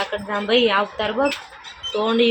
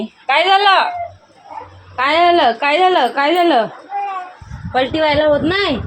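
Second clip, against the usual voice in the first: high, louder coaxing; 8 semitones higher, 5 dB louder than usual. Real, not acted. Mother soothing her fussing baby, high and loud.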